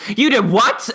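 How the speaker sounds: shocked mom. Retold or imitated. Mom hearing dad told the truth, shocked. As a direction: mom incredulous, half-laughing.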